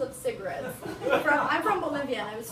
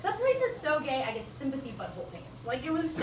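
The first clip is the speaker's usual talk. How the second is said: ordinary talk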